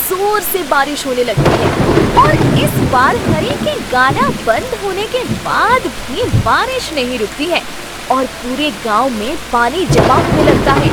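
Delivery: ordinary delivery, nothing marked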